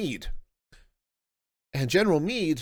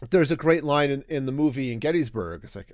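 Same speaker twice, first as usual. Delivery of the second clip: ordinary talk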